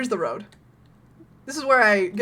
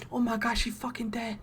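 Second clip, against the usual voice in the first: ordinary delivery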